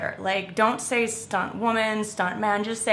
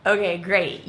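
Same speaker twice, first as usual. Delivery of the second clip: ordinary talk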